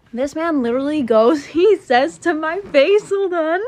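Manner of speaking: exasperated disbelief rising high; incredulous exclaim. Real, not acted. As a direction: voice jumps high and loud in disbelief. Outraged retelling of his words.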